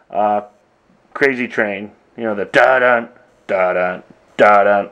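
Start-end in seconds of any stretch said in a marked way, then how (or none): 2.5-4.9 voiced two-note rock riff, three times over; "da-dun" as an imitated percussive intro; mimicked riff intro. Drummer recalling the Crazy Train intro for viewers, imitated riff. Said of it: "It's basically the, uh, the intro to".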